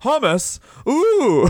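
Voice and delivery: cartoon wonder at snacks; high silly marvel; mock delight. Outlaws delighted by hummus.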